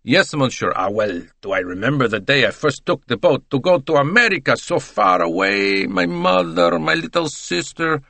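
put-on gushing reminiscence in an Italian accent; sing-song Italian, swept up in memories; voluble Italian. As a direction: reminisce effusively like a sentimental Italian.

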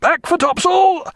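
high slow shouted order. A narrator's reading. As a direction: stretch it out over the guns. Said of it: "he shouted above the thunder".

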